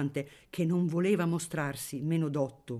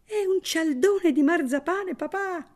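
put-on higher, louder excited child's shout; 5 dB louder than usual. A narrator's reading. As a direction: shout it like an eager little boy. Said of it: "gridò uno dei suoi figliuoli".

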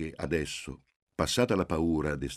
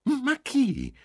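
high, loud, exclamatory question; emphatic; 6 dB louder than usual. Acted. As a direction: stress on chi, thrown upward. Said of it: "con enfasi". (madre enfatica, chi gridato verso l'alto)

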